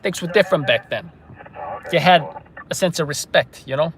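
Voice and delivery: nostalgic Brooklyn wise guy; put-on Brooklyn accent. [name] recalling respect back then, put-on Brooklyn accent. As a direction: lament the old days in Brooklynese. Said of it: "Brooklyn accent".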